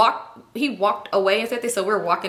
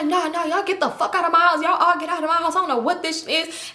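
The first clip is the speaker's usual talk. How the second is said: higher-pitched imitation of an irate host; angry kicking-out; 7 semitones higher than usual. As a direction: act out throwing everyone out. Man throwing them out, acted.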